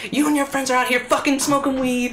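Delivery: imitating an angry mom yelling. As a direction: shout it like a furious mom. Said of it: "And she's like".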